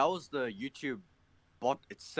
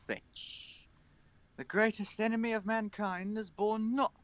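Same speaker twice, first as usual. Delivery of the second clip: higher, solemn zealot's decree; grim inquisitor; 5 semitones higher than usual. Acted. Voicing inquisitor character.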